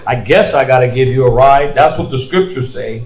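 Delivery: low, put-upon grumble; grudging compliance. Acted. Believer reluctantly offering a ride, grudging. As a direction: agree to the favor as if it's a burden. Acting out grudging, unkind help.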